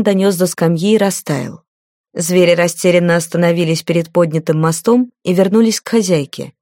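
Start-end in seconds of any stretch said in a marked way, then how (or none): none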